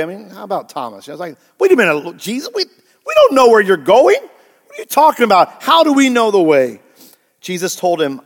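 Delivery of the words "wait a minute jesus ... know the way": high, raised, incredulous questioning; baffled protest. Retold or imitated. Preacher paraphrases Thomas to Jesus, baffled protest. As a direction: object in confusion, voice climbing.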